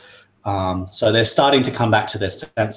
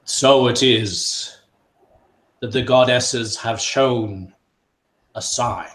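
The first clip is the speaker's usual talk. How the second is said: slow, louder proclamation; booming authoritative voice; 3 semitones higher, 5 dB louder, slower than usual. Acted. The mantle addressing everyone, booming and authoritative. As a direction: announce it like a ruler. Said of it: "his booming authoritative voice".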